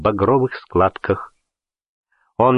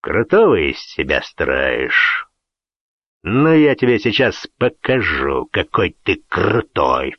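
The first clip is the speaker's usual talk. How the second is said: sinister voice; 5 semitones lower, slower than usual. A narrator's reading. Host threatens before a fight.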